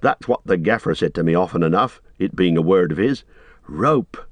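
ordinary delivery, nothing marked

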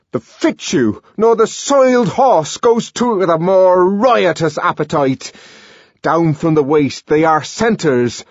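ranting Shakespearean recital, frenzied; raging savage declaiming. Acted. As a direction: declaim bitterly at full voice. Savage rants Shakespeare while pacing.

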